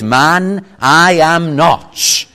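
slow, emphatic, determined denial; firm denial. Acted. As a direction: deny it loudly and firmly, word by word. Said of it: "look how strong".

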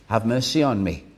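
a quiet plea for mercy; soft plea. Acted. Believer crying to the Saviour, soft and pleading.

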